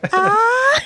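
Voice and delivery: high, loud "ah" of embarrassment; flustered squeal. Acted. Imitating the blushing hero, flustered squeal. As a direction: squeal like someone caught blushing. Blushing hero's reaction acted out.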